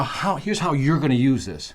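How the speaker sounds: ordinary delivery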